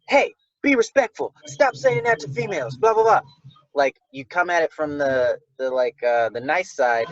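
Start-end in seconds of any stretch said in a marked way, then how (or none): 0.1-3.2 high, loud, harsh call to order; mock stern scolding. Mock-scolding a rowdy crowd, high and loud. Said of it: "it's not like".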